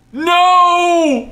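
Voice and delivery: long, high, loud cry of dismay; drawn-out high wail of protest; dismayed yell. Real, not acted. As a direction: yell it out as the game crashes. Crash in the game.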